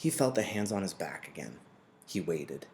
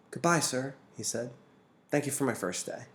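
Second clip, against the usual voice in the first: put-on higher polite boy voice; a boy thanking his teacher; young, polite goodbye; boy Jonas; 6 semitones higher than usual. A narrator's reading. Boy takes leave.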